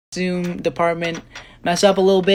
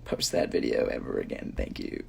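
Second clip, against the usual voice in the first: whispering; 9 dB quieter than usual. Real, not acted. Pleading aside about embarrassing cover.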